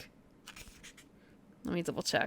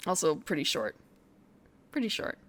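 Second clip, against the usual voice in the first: normal talking voice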